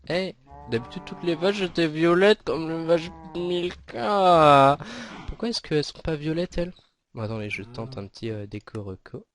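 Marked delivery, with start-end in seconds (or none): 0.1-6.7 real bafflement at the cows; puzzled surprise. Real, not acted. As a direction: sound baffled that the cows changed colour. Cows no longer purple, puzzled.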